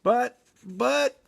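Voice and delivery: high, halting stammer. Acted. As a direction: sputter it out in a panic. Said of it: "Shaggy stammered".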